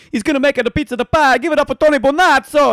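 high, loud mock-Italian announcement. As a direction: belt it out like a hammed-up Italian stage intro. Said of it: "the offensive Indian accent".

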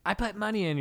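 high, loud mock demand with amusement; mimicked drunk heckler. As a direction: echo the pushy customer, high-pitched. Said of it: "she keeps saying that".

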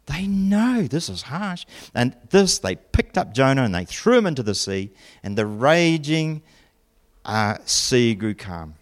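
ordinary delivery

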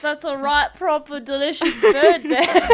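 mock British. Mocking the British guy's line.

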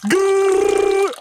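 high, loud mock growl. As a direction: growl it like a beast. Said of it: "my man was just like".

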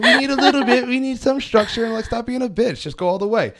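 loud, high-pitched comic argument; hammed-up exasperated quote; mock protest. Acted argument with timid libertarians.

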